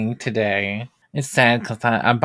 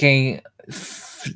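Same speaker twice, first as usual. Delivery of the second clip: usual speaking voice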